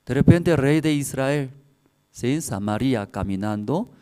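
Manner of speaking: normal talking voice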